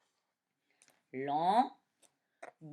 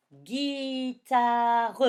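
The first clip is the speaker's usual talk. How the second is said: Guitare stretched long and loud; 「Guitare」を長く大きく伸ばす; drawn-out dictation; 5 semitones higher, 7 dB louder than usual. Shown as a demonstration.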